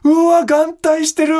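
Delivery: high loud slow giddy exclaim; excited shout. Retold or imitated. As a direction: blurt it out, thrilled and loud. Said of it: "とか言って".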